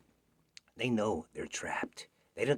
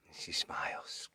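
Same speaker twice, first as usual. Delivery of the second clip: ordinary delivery, nothing marked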